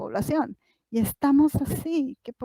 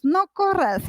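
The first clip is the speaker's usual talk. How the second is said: higher, sharp parental "No corras"; parent's warning; 7 semitones higher, slower than usual. Retold or imitated. A parent tells their child not to run, high, sharp warning.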